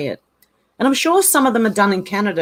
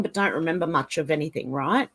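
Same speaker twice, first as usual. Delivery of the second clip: normal talking voice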